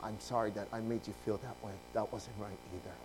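low, soft, gentle regret; sensitive apology. Retold or imitated. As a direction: voice the elder apologising tenderly. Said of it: "he had such a sensitive heart".

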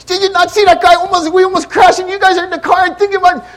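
high, loud, frantic re-enacted yelling; retold angry rant. Mad father to his son after almost crashing, acted angry yelling. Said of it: "saw me physically get mad".

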